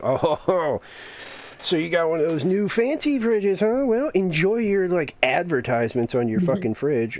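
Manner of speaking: high-pitched taunt, drawn into a jeer; mock teasing. Friends ribbing him about his new fridge, mock teasing.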